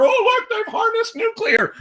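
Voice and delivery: high, mock alien voice; voicing a Vulcan. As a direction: play the Vulcan noticing humans.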